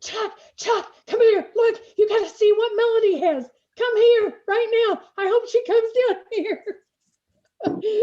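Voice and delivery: high, loud yell imitating an excited caller; imitated yelling. Neighbor calling Chuck over to see the snowblower, imitated yelling. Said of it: "she yells".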